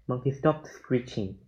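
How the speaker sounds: usual speaking voice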